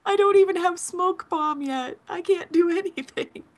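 high, laughing mock despair; laughing panic. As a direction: whine through laughter. Mock panic as stuck player.

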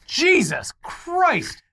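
drawn-out stunned outburst; slow, high disbelief; shocked exclamation. Real, not acted. Reacting to shocking headline.

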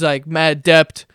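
"debt" drawn out as "dept"; slow down and sound a p in "debt"; mispronounced debt. Retold or imitated. Quoting the friend explaining about credit cards, mispronounced. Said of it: "used to say the word debt".

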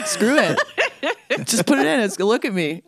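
high, laughing, carefree. Retold or imitated. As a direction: toss it off through laughter. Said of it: "And I was like".

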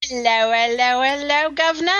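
playful put-on British accent, cheery; mock British accent. Host greets co-host in a hammed-up British accent. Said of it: "Mary Poppins accent right there".

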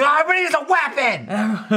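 high, frantic mock yell; freaking out. Friend quoting a favorite sitcom bit, freaking out. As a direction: blurt it out in an exaggerated panic. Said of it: "Dennis freaks out".

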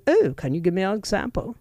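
imitated South African British lilt; put-on British-South African voice; South African British accent. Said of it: "Elon Musk has the South African British accent".

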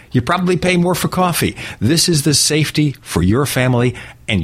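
upbeat radio ad read. Acted. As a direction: sell it like a radio spot. Host reads live ADT ad.

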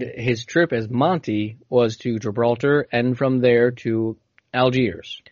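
ordinary delivery

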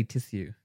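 "tissue" said as tiss-yoo; posh tissue. Shown as a demonstration. Friend demonstrating how some people say it, posh tiss-yoo. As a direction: say "tissue" with a crisp s-y, no sh. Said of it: "Why do you put that voice".

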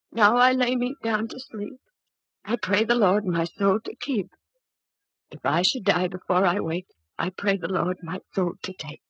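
bedtime prayer. Acted. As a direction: recite it like a child's nightly prayer. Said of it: "Like this".

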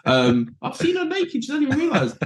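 very high, squeaky amazement; giddy mock-awed inner voice; young player buzzing beside a glamour model; starstruck.

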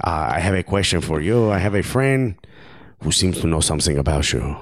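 mock French accent slipping into Argentinian; comic French-Argentinian accent; Karim Benzema. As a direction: put on a wobbly foreign accent, French drifting Argentinian. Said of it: "I promise this is Karim Benzema".

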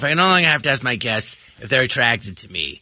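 lightly voiced Fierstein reassuring himself; Harvey Fierstein impression. Comic retelling the sketch opening, low impression. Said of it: "I start off".

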